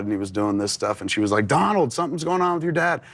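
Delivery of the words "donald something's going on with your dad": higher-pitched imitation of an alarmed mother; mildly urgent retold cry for help. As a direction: call out for help, pitched up, without shouting. Retold mother calling brother in alarm.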